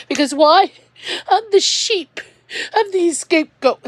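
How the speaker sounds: breaking into tears mid-sentence; crying. Real, not acted. Blamed for everything by family, crying. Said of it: "I'm so crying up still this".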